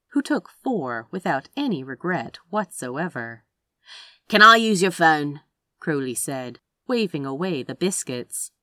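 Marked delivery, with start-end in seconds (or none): 4.3-5.4 loud, insistent character voice; Crowley's brusque request. A narrator's reading. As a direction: demand it with little patience. Crowley cuts in impatiently.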